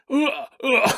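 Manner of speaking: high excited grunts; bigfoot grunting. Acted. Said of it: "And he's like".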